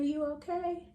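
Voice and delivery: usual speaking voice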